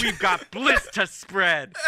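slow, loud, high declaration; Colm. Acted. Future king about to ride out, blissful proclamation. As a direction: spread the bliss grandly. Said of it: "and Colm's like".

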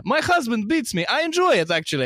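very high mocking falsetto; mock Russian wife. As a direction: chirp it like a proud wife. Russian woman voiced mockingly.

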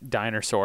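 "dinosaur" said as "diner-saur"; diner pun. Shown as a demonstration. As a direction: say "dinosaur" with diner as its first part. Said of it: "No, the diner".

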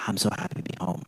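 husband softly greeting his wife; tender, soft welcome. Retold or imitated. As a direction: murmur it into a hug. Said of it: "I say".

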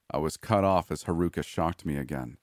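plain reading voice

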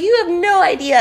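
high, indignant re-enacted outburst; heated retort. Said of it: "I'm screaming on your podcast".

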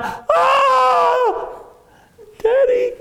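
put-on little boy crying out to his father as he falls; terrified cry. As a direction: scream for daddy while falling.

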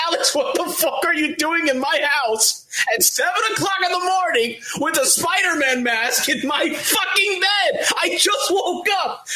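high, incredulous yelling; shocked shout. Retold or imitated. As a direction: yell at him in disbelief. Retold shock at friend.

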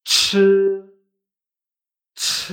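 CH with a strong puff of air; aspirated ch. Shown as a demonstration. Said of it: "pero sacando aire".

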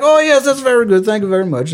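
high, put-on Borat-style foreign accent; Borat impression. An American in Belgium mocking his own foreign accent with a Borat-style voice. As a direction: ham up a Kazakh-style foreign accent as a joke. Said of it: "that stupid Borat impression I just did".

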